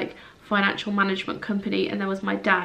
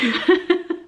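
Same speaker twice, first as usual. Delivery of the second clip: light laugh; 8 semitones higher, 3 dB louder than usual. Real, not acted. Self-conscious laugh after emotion.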